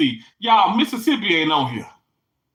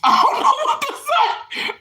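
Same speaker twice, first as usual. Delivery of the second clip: much higher, incredulous; stunned. Real, not acted. Stunned after reading what scrapple is. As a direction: say it like you can't believe it.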